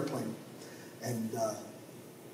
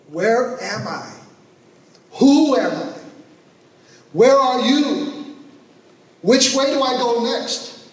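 rising desperate pleas, louder and higher; anguished cry to God; 8 semitones higher, 6 dB louder than usual. Acted. Lost believer crying out to God, high and loud.

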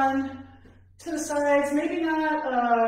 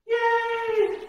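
excited cheer; 10 semitones higher, 3 dB louder than usual. Real, not acted. Celebrating the end of a set.

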